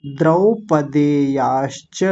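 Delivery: द्रौपदेयाश्च slowly with open द्रौ; teacher demo; slow syllables. Shown as a demonstration. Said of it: "फिर से दो मात्राएं आई".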